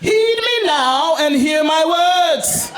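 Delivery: grand address. Retold or imitated. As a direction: declaim slowly like a mock statesman. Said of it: "I address the gentleman".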